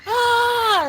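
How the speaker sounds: high, loud tantrum shriek; mock child meltdown, building to a scream; screaming, yelling child. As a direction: imitate a kid refusing and wailing. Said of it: "she's screaming and kicking and yelling".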